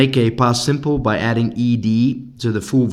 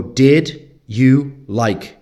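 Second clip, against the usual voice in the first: Did you like, said very slowly with weight on did; slow model question; 4 dB louder, much slower than usual. Shown as a demonstration. Teacher slowly models the correct question form. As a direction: spell out each word for the class. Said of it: "You go".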